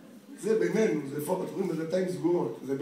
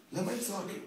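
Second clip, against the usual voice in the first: ordinary talk, nothing marked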